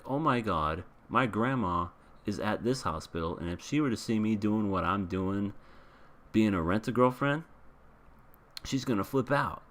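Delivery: normal talking voice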